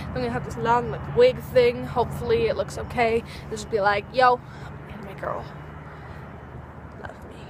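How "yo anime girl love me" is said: soft, breathy come-on, fading away; flirty whisper.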